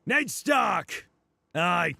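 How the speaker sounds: loud, high northern shout; northern accent. Shown as a demonstration. Voice actor doing the veteran in a loud northern shout. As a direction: bellow it like a northern lord. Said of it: "Northern accents are kinda lip bite".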